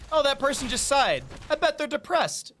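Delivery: high, put-on voice jumping to conclusions; mock-concerned high voice; mock reader of chat. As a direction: overread a stranger's chat message. Mock reading of someone's chat.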